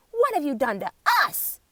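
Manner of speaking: demand. A narrator's reading. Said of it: "demand the other animals".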